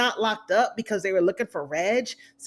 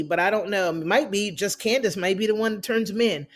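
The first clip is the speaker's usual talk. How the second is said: ordinary delivery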